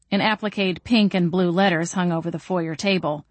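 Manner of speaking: ordinary delivery, nothing marked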